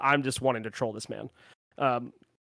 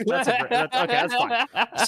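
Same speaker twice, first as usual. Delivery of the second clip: laughing; 10 semitones higher, 3 dB louder than usual. Real, not acted. Laughing at the cohost's name.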